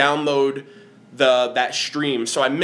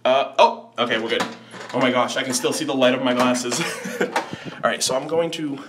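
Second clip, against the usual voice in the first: ordinary delivery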